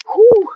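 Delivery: high-pitched puff of relief; high whew. Real, not acted. Trainer puffing between backpedal sets, high whew. Exhaling hard during workout.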